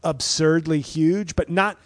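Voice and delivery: ordinary delivery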